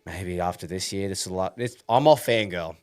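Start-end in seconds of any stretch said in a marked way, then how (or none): none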